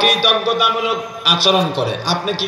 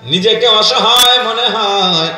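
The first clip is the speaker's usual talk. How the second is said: raised sing-song sermon chant; melodic preaching; 3 semitones higher than usual. Real, not acted. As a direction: preach it in a chant, stretching the line endings. (সুর করে ওয়াজ, টেনে টেনে)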